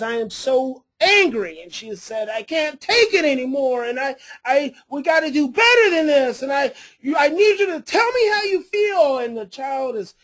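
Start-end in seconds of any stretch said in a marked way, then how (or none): 0.0-9.2 imitating an upset mom, rising ever higher and louder. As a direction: voice the mother's pleading anger, climbing higher. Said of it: "and she says".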